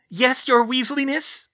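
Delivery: high, loud, teasing voice; squeaky impish servant; mischievous poltergeist. A narrator's reading. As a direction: answer with mock deference, pitched high. Poltergeist answers.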